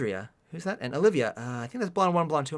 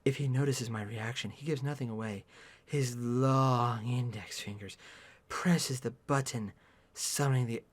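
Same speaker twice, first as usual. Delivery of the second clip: low, soft, slowing reading; hushed first-person narration; story narration; 6 semitones lower, 8 dB quieter, slower than usual. A narrator's reading. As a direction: narrate quietly, stretching out the details. Reads the story aloud.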